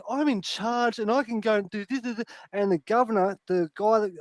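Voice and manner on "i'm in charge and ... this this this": higher, mock self-important; a hint of a bossy woman. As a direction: lightly mimic her bossiness. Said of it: "she thinks".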